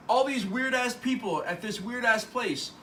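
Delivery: lightly hammed-up disbelief; mock bewilderment. Acted bewildered complaint in retelling.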